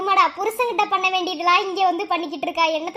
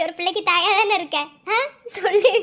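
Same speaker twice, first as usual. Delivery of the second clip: usual speaking voice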